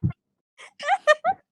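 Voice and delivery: high, loud and fast through giggles; amused call-out. Real, not acted. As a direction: tease someone across the room, loud and high. Laughing call to someone off mic.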